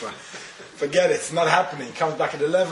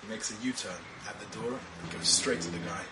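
hushed storytelling; 5 semitones lower, 8 dB quieter than usual. A narrator's reading.

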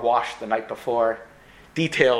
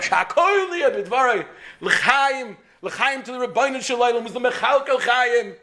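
tipsy toast; 10 semitones higher than usual. Acted. Tipsy husband toasting at bar.

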